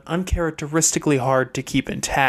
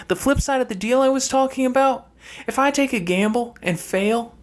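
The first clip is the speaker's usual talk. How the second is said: higher sister's voice, cool and matter-of-fact; a younger sister laying out hard truths, voiced higher; Fernanda's voice; 7 semitones higher than usual. Fernanda explains her place to brother Chase, higher sister voice.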